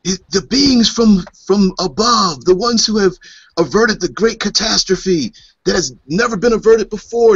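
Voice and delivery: high-pitched, reverent exclaiming; awed worshippers. Acted. Temple crowd greeting beings from above, awed. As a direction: exclaim like a crowd bowing to gods.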